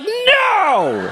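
high, loud laughing "No!"; mock shout. Refusing the stranger's help.

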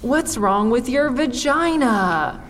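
mock audience voice. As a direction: drag it out like a baffled heckler. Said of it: "You're all thinking".

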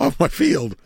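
high, loud mock Italian accent; Mario. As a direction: finish the hammed-up Italian character voice after a laugh. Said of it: "Just do it in your best Mario accent".

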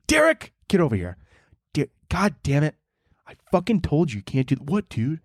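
acted annoyed outburst; exasperated robber. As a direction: tell off your useless accomplice. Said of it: "one guy's, like, very serious about robbing".

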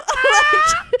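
loud high cackle of delight; high shrieking laugh. Real, not acted. After mock song.

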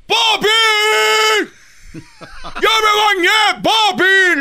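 hammed-up, very high-pitched yell; screaming child. Boy telling his dad he has bathed, comic screaming. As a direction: scream for papi at the top of your voice. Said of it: "le gritaba yo así".

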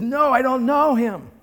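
loud, high outburst; cursing denial. Acted. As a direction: swear he doesn't know him. Peter curses, denies.

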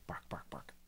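hushed, whispered dog barks; quiet mock bark. Game master playing visitor at the door, hushed mock bark. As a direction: say the barks softly, as a token dog.

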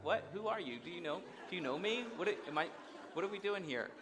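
high, startled, incredulous questions; acting thrown by a friendly stranger; baffled city guy. City man baffled by a friendly stranger, high and incredulous. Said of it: "I'm like".

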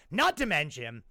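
normal talking voice

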